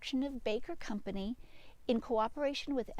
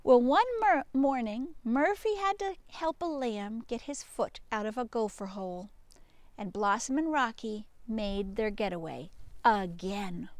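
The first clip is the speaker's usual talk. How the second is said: usual speaking voice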